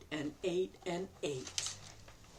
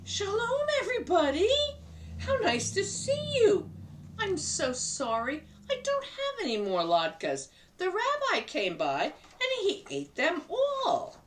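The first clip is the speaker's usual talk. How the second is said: higher, cheerful grandmother voice; grandma Bubba; 14 semitones higher than usual. Acted. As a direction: play the kindly grandma talking to her guest. Bubba greets her friends.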